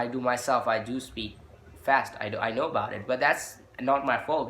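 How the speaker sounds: usual speaking voice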